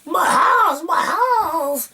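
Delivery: high mock wail of a distraught woman; a high, hammed-up wail of a woman whose house is flooded; imitating screaming woman. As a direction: wail it like she's lost everything. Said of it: "started, like, screaming about her house".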